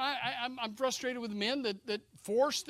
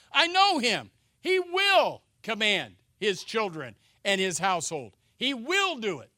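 booming proclamation; 2 semitones higher, 9 dB louder than usual. Acted. Preacher proclaims God's words.

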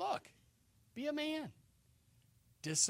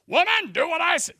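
loud, higher mock bark at the wife; mock tyrant husband; 10 semitones higher, 13 dB louder than usual. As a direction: ham up a bossy husband barking orders. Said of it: "What's that mean?".

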